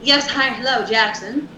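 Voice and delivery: a hint of an old Hollywood voice; lightly voiced transatlantic accent. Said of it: "Teach him to speak in the transatlantic voice".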